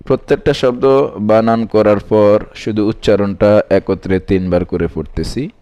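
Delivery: ordinary delivery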